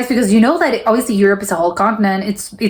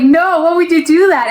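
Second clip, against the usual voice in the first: higher-pitched incredulous objection; mock disbelief; 8 semitones higher, 3 dB louder than usual. Reacting to tourists picking fast food, mock disbelief. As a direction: protest it, pitched well up.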